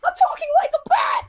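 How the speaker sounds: hammed-up vigilante shout, strained and distorted; Batman. Answering in a retold comedy skit, overdone Batman shout. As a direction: shout it as an absurd parody of a masked superhero. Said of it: "pretends to be Batman workshopping his voice".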